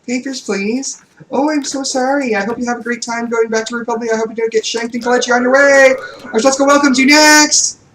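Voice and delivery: high, fast fake-sweet clerk; sugary sarcastic service voice; customer service voice. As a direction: rattle off polite cruelty with a smile. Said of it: "my customer service voice".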